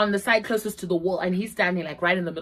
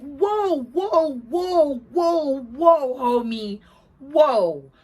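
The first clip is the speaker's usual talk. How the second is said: stunned whoa; 5 semitones higher, 3 dB louder, much slower than usual. Retold or imitated. Backing off from the accusation.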